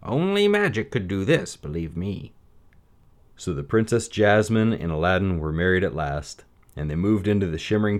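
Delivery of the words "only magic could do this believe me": a knowing, scheming adviser; Vizier voice. A narrator's reading. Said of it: "his Grand Vizier said".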